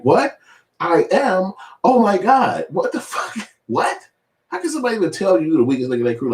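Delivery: very high, shocked whimper; mocking a cohost stunned at being called the weakest link; mocking Zoe. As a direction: gasp like you've been insulted. Mocking Zoe's shocked reaction.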